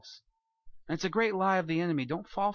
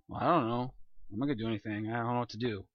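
mock apathetic shrug; 8 semitones lower, 5 dB quieter, faster than usual.

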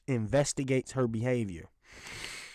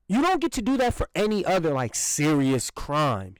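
ordinary delivery

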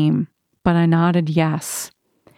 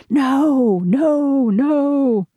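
long, high drawn-out cries of protest; crowd's roar; 7 semitones higher, much slower than usual. A narrator's reading. Crowd answering the showman's plea, drawn-out roar. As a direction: cry it out high and sustained, without full shouting. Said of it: "There was a shattering roar".

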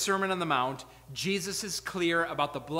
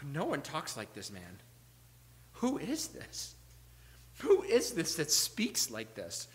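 soft, awed murmur of a crowd; quietly acted astonishment; hushed amazement; 2 semitones lower, 8 dB quieter than usual. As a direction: wonder aloud, barely daring to say it. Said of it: "people say".

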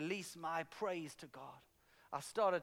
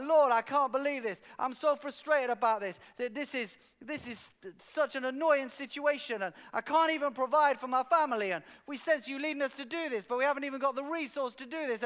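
loud, high frustrated complaint to God; frustrated rant; 7 semitones higher, 5 dB louder than usual. Retold or imitated. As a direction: vent it all out, exasperated and loud. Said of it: "going for another rant".

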